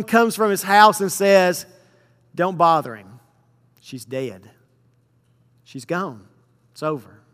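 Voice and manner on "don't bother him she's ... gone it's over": low, much softer, grave; hushed bad news. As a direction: break the news gently and heavily. Messenger brings news of death.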